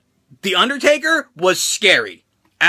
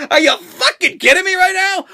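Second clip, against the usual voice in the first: higher, louder mock disbelief; incredulous outburst; 10 semitones higher, 4 dB louder than usual. Rookie reacting to the blading plan, higher mock disbelief. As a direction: throw up your hands in mock disbelief. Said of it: "And I'm like".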